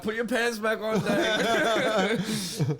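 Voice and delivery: crowd heckle. Retold or imitated. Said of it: "Someone yells out".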